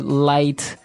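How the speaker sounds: ordinary talk, nothing marked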